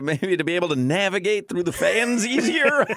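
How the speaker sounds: amused, breaking into laughter; laughing. Real, not acted. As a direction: crack up while guessing at an answer. Joking about the slimmer mascot.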